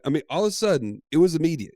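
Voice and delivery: ordinary delivery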